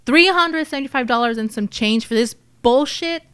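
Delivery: acted indignant outrage, pushed out; livid. Narrator playing someone cheated by the lockbox haul, livid. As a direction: throw the measly sum back in disbelief. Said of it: "I would be livid".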